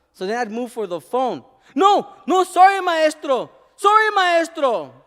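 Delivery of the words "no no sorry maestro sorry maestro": put-on high, loud hurried child's sorry; a kid begging not to be reported; boy begging teacher not to call his mom; panicked child apology. Child panicking about phone call.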